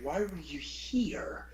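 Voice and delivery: slow hissed whisper from mom; angry mom whispering at the kid; whispered scold. Retold or imitated. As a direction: whisper it, barely controlled. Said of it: "She's like".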